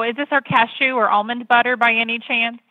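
ordinary delivery

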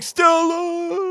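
very high, drawn-out mock wail; mock anguished cry. Retelling the man calling his dying love, mock anguished cry. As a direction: wail the name like a hammed-up melodrama.

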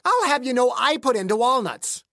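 put-on high, loud indignant wife in a strange accent; affronted, high northern accent; indignant local accent. A narrator's reading. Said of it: "gave her husband a dirty look".

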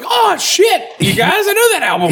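high, fast, excited yell; excited shout. Retold or imitated. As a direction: shout it out, thrilled. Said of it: "I was like".

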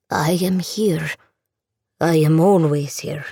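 put-on slow, distant old grandfather; a ghostly voice through the radio; unearthly voice. A narrator's reading. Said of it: "the voice said".

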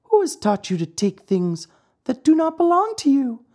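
very high, tiny voice of reproof; scolding glowworm. Acted. Glowworm scolds the puppet for stealing, very high. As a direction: scold him in a little creature's high voice.

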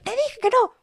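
high-pitched mock shout at normal volume; mock yell. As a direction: imitate a mother losing it, very high. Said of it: "tal vez llego a gritar". (grito fingido, muy agudo)

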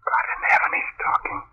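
breathless frightened whisper; terrified whisper. Acted. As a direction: whisper it in terror at the ghost.

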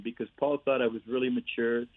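ordinary talk

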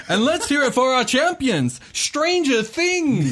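high, loud, drawn-out champion call; booming prize announcer. Acted. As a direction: stretch the team name for the crowd.

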